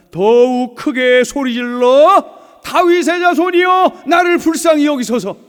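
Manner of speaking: high, loud, slow shout of the blind beggar; full-voiced desperate shout to Jesus. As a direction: cry out with everything. Preacher shouts Bartimaeus' louder cry.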